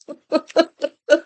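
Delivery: bright burst of laughter; real laugh. Real, not acted. Laughing at mom's lobster logic.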